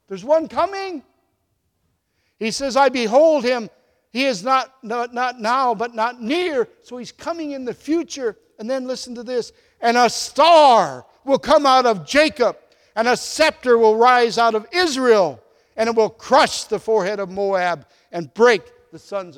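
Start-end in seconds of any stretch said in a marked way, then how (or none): 2.7-6.7 proclaimed prophecy. Acted. 9.8-19.4 proclaiming scripture. Acted. Reads the star prophecy aloud.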